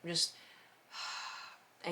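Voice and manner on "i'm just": ordinary talk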